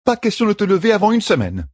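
high, firm midwife voice; midwife's verdict. A narrator's reading. Midwife orders the queen to bed, firmly. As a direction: hand down the order to rest.

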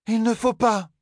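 high pleading cry; anguished cry. A narrator's reading. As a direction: cry out to stop the fight. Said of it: "s'écria Erith". (cri aigu et suppliant)

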